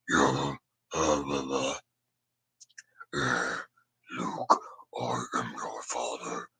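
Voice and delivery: low, slow, breathy whisper of a masked villain; Darth Vader quote. Retold or imitated. Beatboxer quoting the villain to his viewers, low raspy whisper. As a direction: rasp the line out slow and low like a movie villain.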